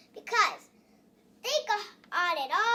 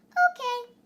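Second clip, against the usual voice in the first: higher, louder one-word reply; God answering; 6 semitones higher, 8 dB louder than usual. Acted. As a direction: say it up high and loud, as God agreeing. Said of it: "And God said".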